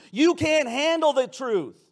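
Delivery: high, loud, slow shouted quote; a hammed-up Nicholson yell; mock yell. Shown as a demonstration. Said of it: "Jack Nicholson yells".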